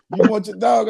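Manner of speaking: high-pitched retold neighbor asking; retold neighbor's question. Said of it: "I'm talking about the real say".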